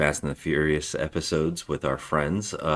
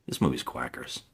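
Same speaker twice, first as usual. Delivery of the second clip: quiet deadpan aside; 7 semitones lower, 9 dB quieter than usual. Retold or imitated. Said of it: "and I go".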